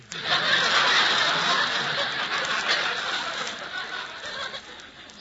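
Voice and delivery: big audience laugh; audience laughter. Real, not acted. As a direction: let the crowd roar. Studio audience laughs at joke.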